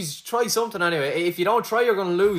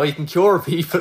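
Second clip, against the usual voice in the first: ordinary talk, nothing marked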